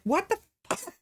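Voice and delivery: high-pitched imitation of his disbelief; mock dumbfounded question; bewildered mock exclamation. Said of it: "felt sorry for him in the moment".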